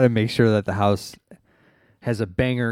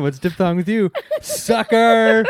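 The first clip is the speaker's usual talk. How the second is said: higher, louder mock taunt; teasing hammed-up jab; joking tough-guy taunt; 12 semitones higher, 5 dB louder, slower than usual. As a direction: razz them like a playground wisecracker. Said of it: "I feel like that's a joke".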